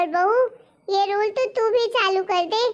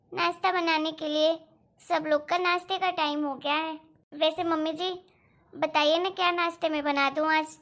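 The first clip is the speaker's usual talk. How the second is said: put-on softer, lower, dutiful young bride; daughter-in-law voice; 3 semitones lower, 6 dB quieter than usual. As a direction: speak gently and respectfully to the mother-in-law. (धीमी, आदरपूर्ण बहू की आवाज़)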